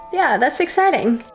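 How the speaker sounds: ordinary delivery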